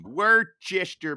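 "Worcester" sounded out as WUR-chester; deliberate mispronunciation. Shown as a demonstration. Joker mangles the brewery's town on air, mispronounced as WUR-chester. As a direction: say "Worcester" with a full "chester" ending. I'm gonna mispronounce every city.

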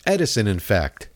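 ordinary talk, nothing marked

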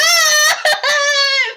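long, high, loud shriek; running scream. Acted. Remy waddling away in flight, high loud scream. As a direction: scream at full height while fleeing. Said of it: "and she's like".

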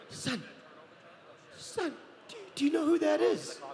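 high, breathless urgent whisper rising up; excited nana. Retold or imitated. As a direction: shake him awake with the news. Nana shaking him, excited.

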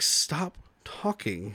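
very slow, soft, low retold plea; muted exasperation. Host voicing the characters fed up with the cover story, slow and hushed. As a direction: drag it out under the breath.

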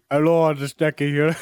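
put-on accented voice, introducing himself; comic impersonation with a foreign accent; Eki. Co-host imitates on the host's request, accented impression. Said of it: "talk like Eki".

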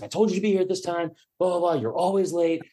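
imitated scolding, pitched high without shouting; host ripping into him. Host berates comic for being late, mock scolding. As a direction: rattle off a host's irritated complaint. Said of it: "ripping into me".

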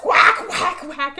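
high, loud whacks; acted axe blows. Zombie keeps hitting him, loud mock whacks. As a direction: call out each blow like a sound effect.

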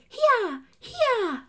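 mock the narrator's thin squeal at the horse; silly narrator call. Imitating the audiobook narrator spurring a horse, high and squeaky. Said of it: "she goes".

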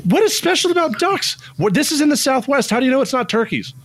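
high-pitched exasperated outburst about ducks; worked-up rant. Real, not acted. Host to co-hosts, venting over ducks in an exasperated, high-pitched rant. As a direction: rant high and baffled, voice climbing. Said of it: "you are yelling at me".

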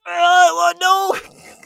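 high, loud wail of dread; mock panic. Actor dreading director's accent question, mock-panicked wail. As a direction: cry out in exaggerated dismay, pitch shooting up.

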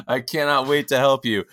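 loud, bright promise; eager player. Retold or imitated. Draft pick thanking the coach, excited. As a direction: promise it with joy. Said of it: "And he goes".

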